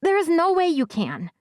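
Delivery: put-on high young boy's voice with a lisp; little boy with a lisp. A narrator's reading. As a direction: voice a small lisping kid. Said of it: "a younger boy with a bit of a lisp".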